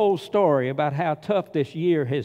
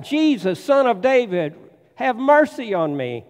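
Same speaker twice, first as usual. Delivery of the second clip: high, loud cry for mercy; pleading cry; 7 semitones higher, 5 dB louder than usual. Retold or imitated. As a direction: call out from the roadside, begging. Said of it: "And so he yells out".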